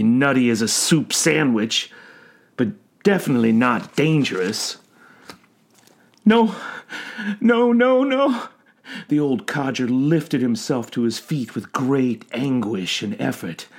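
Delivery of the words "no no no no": high, loud anguished cries; distraught old man crying out; panicked refusal. A narrator's reading. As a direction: wail it in rising panic.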